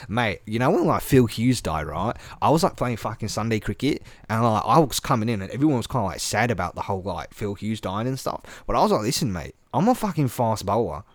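mock Aussie fast bowler; swaggering, sweary Australian storyteller; Australian accent. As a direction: imitate a defiant Aussie cricketer. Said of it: "everything you expect from an Aussie".

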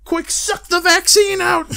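high, loud frantic yell; mock panicked Twitter user. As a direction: imitate someone yelling in panic. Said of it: "people on Twitter being like".

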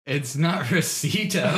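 slow, laughing correction. Real, not acted. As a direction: say it through a laugh.

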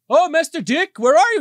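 high, loud calling butler; Alfred calling out. Retold or imitated. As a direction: call up the stairs like a butler. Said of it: "Alfred's like".

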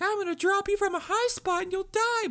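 very high falsetto cartoon voice; hammed-up high-pitched threat; squeaky mock threat. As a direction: mimic a tiny villain in squeaky falsetto. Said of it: "just be like".

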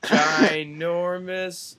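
ginormous stretched out very slowly; hammed-up emphasis. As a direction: drag ginormous out for laughs. Hyping up big news.

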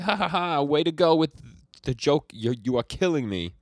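loud, high acted cackling, easing down; mock hysterical laughter. Host voices Rion cracking up at Azuki, mock hysterical laughter. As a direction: crow it with a big fake laugh. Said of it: "just starts laughing hysterically".

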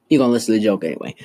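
playfully bossy line to a crush, said fast; pushy and fast. Retold or imitated.

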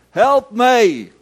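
high, loud cry of help me; shout for help. Retold or imitated. Blind man in the shop shouting for help, loud cry. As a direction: yell it across the shop. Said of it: "He shouted out".

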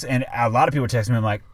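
ordinary delivery

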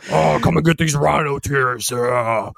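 lightly voiced conspiracy salesman; mock Alex Jones pitch. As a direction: hawk supplements like a radio huckster. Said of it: "He sells all that other shit".

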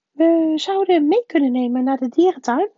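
high, loud woman's voice; aunt thinking aloud. A narrator's reading. Aunt Petunia weighs taking him to the zoo, pondering. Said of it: "zei tante Petunia langzaam".

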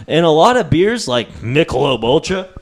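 hammed-up beer ad pitch; mock ad read. Hosts jokingly plugging their sponsor, mock ad read. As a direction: sell it like a beer commercial.

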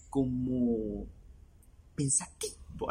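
drawn-out, near-voiceless pensive impression; soft, hesitant and breaking off; Jaime Bailey. Shown as a demonstration. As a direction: imitate a musing host, pausing mid-phrase. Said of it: "como Jaime Bailey habla así".

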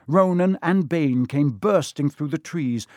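plain reading voice

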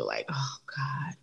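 slow, breathy whisper of embarrassment; hushed oh God, cringing at the memory; embarrassed whisper. Real, not acted. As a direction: let it slip out as a mortified whisper. Cringing at her own memory.

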